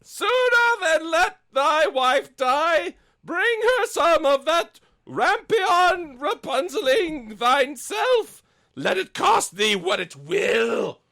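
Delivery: very high, slow, loud mock husband shout; hammed-up husband. The husband resolves to steal rampion, high slow hammed-up shout. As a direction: bellow the husband's resolve in a silly falsetto.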